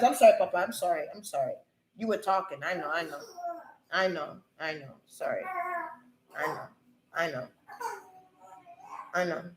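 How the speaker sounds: hushed, low-pitched soothing; soft apology. Real, not acted. Apologizing to Papa for talking over him, soft and soothing. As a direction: drop right down to placate.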